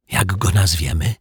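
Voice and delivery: ordinary delivery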